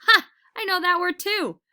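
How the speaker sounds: high, loud and delighted; bursting with proud glee; eager puppy who knows the trick; excited. A narrator's reading. Excited dog recognizes command.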